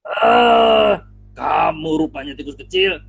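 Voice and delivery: loud gruff lion snarl; angry lion. Acted. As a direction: roar at the little mouse. Singa terbangun marah. (singa marah membentak tikus kecil)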